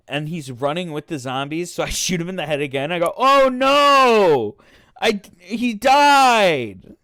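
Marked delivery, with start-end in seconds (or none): 3.2-7.0 loud, high, drawn-out fake cry; hammed-up wail of pretend horror; mock dismay. Storyteller replaying his fake shock at shooting Charlie, mock dismay.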